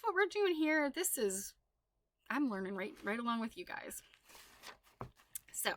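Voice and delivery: usual speaking voice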